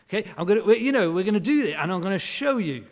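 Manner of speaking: loud, fast, huffy show-them grumble; chuntering defiance. Retold or imitated. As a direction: grumble that you'll prove them wrong. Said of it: "imagine the chuntering".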